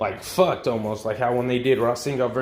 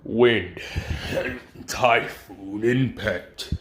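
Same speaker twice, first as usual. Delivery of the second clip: slow, lower, weighty naming of each word; dramatic attack shout, held back; jutsu callout; 3 semitones lower, much slower than usual. Retold or imitated. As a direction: call out the attack like an anime finisher, pausing between words. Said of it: "and says".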